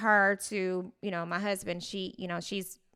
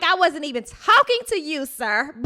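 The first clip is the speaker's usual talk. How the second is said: sassy retort; 7 semitones higher, 9 dB louder than usual. Retold or imitated. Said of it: "I was like".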